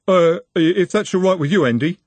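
high, stammered backtrack; hesitant deference. Acted. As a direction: backtrack and check with the boss. Deferring nervously to Andy, then relieved.

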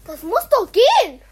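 loud, high outburst at the phone; frustrated shout. Real, not acted. Kid yelling at a phone game that won't load. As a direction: yell it out in real frustration.

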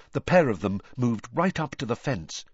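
usual speaking voice

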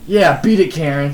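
loud, high-pitched send-off; mock brash dismissal. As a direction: shoo her away loudly. Imagined friend dismissing the mother.

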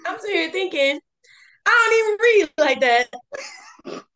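laugh-crying through words; cracking up while talking. Real, not acted. Laughing at own book choice.

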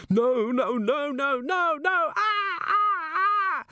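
very high wailing terror; modulated scream. A narrator's reading. Thief outside in the street, screaming. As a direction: wail the nos up into a long high scream. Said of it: "a third scream, modulated with".

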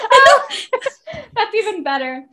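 high burst of laughter through the words; laughing. Real, not acted. Laughing at her co-host's nineteen correction, high and bubbly.

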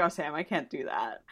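quiet, sincere little Cass line. Retold or imitated. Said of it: "he's like".

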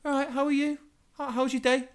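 high mock-cheery hello; chirpy greeting. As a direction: parody a cheerful coworker. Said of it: "they just come up to you and they go".

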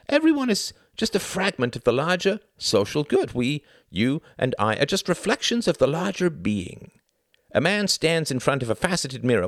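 high-pitched ideological oration; fervent zealot. Acted. Klaus preaches the group over the individual, fervent. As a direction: build insistently through the list and argument.

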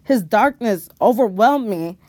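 plain reading voice

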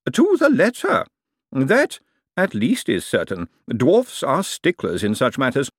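smooth, knowing lawyer holding forth; lawyer's voice. A narrator's reading. Lawyer explaining the lease to Miss Dearhart, lightly voiced. Lawyer answers about the contract.

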